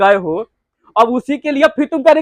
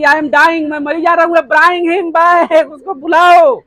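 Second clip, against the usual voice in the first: loud, high hysterical shout; frantic plea; 6 semitones higher, 4 dB louder, much slower than usual. Acted. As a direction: scream for him to be brought back. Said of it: "Natalia कहती है कि".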